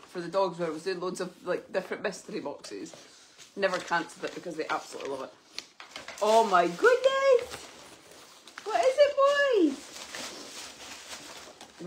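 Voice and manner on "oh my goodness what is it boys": dog talk. Real, not acted. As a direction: coo excitedly at the dogs. Excited voice for dogs.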